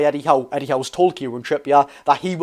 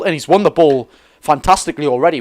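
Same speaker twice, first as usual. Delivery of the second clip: normal talking voice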